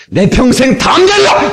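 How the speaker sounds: high, forceful declaration; rousing battle-cry delivery; bold, with backbone. Shown as a demonstration. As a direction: hit 담대하리라 high and rising with full conviction. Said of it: "이래야지".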